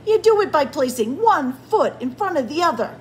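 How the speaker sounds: fast, high, loud explanation; exasperated sarcasm. A narrator's reading. Grumpy Bird hints to Beaver, exasperated. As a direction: spell it out impatiently.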